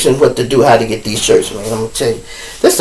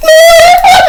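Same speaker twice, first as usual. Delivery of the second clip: whiny high groan; 7 dB louder than usual. Retold or imitated. Defendant whining.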